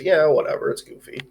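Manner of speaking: usual speaking voice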